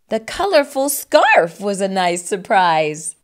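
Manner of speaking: ordinary delivery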